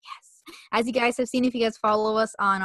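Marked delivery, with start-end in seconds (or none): 0.0-0.5 a tiny whispered celebration; whispered yes. Retold or imitated. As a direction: whisper it like a secret victory. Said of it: "which I'm like".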